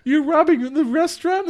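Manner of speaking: high-pitched, astonished question; hammed-up disbelief; mock incredulity. Retold incredulous reply.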